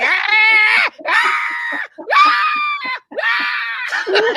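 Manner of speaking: loud, high-pitched infant wail; mock newborn screaming in long bursts; imitated baby crying. Imitating the colicky baby on sister's lap, screaming cry.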